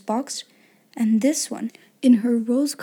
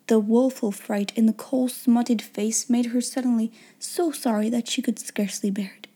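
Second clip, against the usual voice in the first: ordinary delivery, nothing marked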